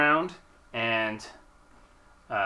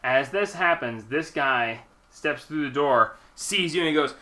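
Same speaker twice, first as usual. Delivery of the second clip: normal talking voice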